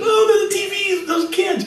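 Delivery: very high squeal of recognition; excited kids. Retold or imitated. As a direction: squeal like a child spotting stars. Paul's kids recognising the band.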